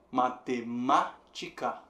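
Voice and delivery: "matemática" slowly, syllable by syllable, peaking on "má"; stress on má. Shown as a demonstration. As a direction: break up "matemática" around a strong "má". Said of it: "ma sendo a forte". ("matemática" devagar, sílaba por sílaba, com "má" forte)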